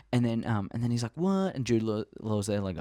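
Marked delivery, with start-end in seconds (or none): 1.1-1.5 high squeaked what; mock surprise. Kid reacting to the old crew, high mock surprise. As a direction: yelp it in shock.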